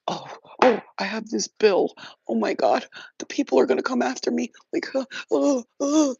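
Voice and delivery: high, loud, hammed-up fretting over a bill; high, loud mock panic with stammered uh uh. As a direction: wail like the collectors are coming. Mocking people panicking over bills.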